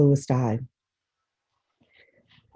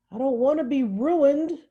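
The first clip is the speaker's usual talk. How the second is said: tearful; 11 semitones higher, 5 dB louder than usual. Acted. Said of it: "The tears came back".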